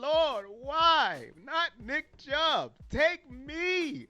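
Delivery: a half-joking plea to take his knee instead; mock prayer. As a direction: beg God to spare your running back. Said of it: "That was my first reaction".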